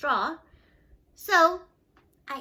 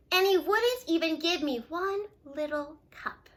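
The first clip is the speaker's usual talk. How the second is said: ordinary delivery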